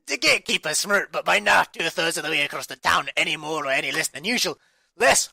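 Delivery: loud, high, manic Scottish read; runaway Scottish accent. Pretend. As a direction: charge through the story in a hammed-up Scottish accent. Reading ran away into loud accent.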